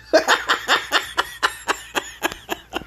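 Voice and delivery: high breathless cackle; high burst of laughter. Real, not acted. Laughing at his own jab at neighbours, high cackle. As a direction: crack up, high-pitched.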